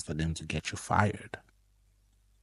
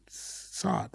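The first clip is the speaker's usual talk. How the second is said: whispered sigh; 11 semitones higher, 9 dB quieter than usual. Real, not acted. Lament about nosy Germans.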